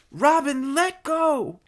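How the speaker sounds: high, loud pleading command; urgent cry. Acted. Mary Ann begging Robin to release, crying out urgently.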